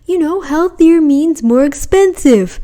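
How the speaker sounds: parroting a vegan marketer's pitch; mock vegan marketer. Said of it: "they're gonna say".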